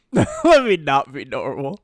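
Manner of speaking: loud, laughing mock repeat; teasing repeat cracking up; laughing echo. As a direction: echo it back through a laugh. Laughing repeat of co-host's phrase.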